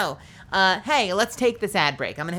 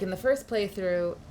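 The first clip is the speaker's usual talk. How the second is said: ordinary talk, nothing marked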